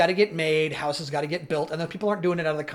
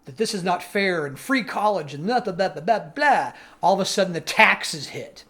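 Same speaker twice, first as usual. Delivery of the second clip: usual speaking voice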